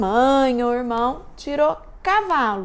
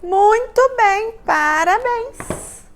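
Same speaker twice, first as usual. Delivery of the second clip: cheerful praise; 8 semitones higher, 3 dB louder, slower than usual. Real, not acted. Teacher praising children.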